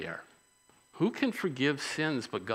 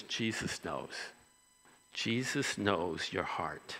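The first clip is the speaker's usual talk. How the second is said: earnest; 4 semitones lower, 6 dB quieter, slower than usual. Real, not acted. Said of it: "Remember this".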